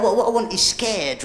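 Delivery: normal talking voice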